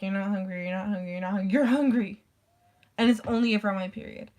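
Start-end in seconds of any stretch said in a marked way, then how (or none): 1.5-2.2 louder, abrupt jump out of plain talk; spring it suddenly, louder; sudden announcement. Acted. Body abruptly announcing hunger to its owner, sudden loud burst. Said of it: "my body's like".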